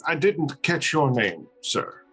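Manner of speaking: ordinary talk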